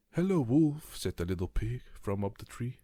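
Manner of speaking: high, loud teasing pig voice; sing-song taunt from up the tree; little pig teasing the wolf below, high and loud; cheeky little pig. Acted.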